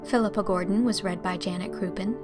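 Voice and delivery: ordinary talk, nothing marked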